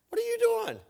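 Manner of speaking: hammed-up disbelief at the stranger; incredulous. Stranger sitting on his stuff.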